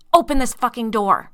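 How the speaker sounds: full-throated angry shout; man pounding at a locked door, screaming; furious scream. Acted. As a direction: yell it with rage through the door. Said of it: "he screamed".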